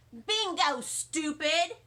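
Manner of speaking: ordinary delivery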